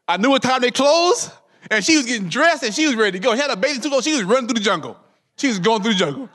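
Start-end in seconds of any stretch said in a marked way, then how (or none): none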